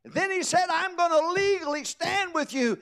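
high, loud, ringing sermon climax; full-force preaching. Acted. Preacher lifting the congregation, full-force preaching.

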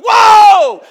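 very loud high whoa; mock stop shout. Son pranking his dad's truck, very loud high whoa. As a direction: yell it to halt the truck in a prank. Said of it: "I'd go".

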